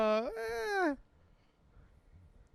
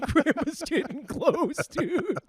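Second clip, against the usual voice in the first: high, drawn-out warning; slow, high-pitched mock panic; mock alarm; 10 semitones higher, much slower than usual. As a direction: imitate a teenager sounding the alarm. Retelling his panicked teenage reaction.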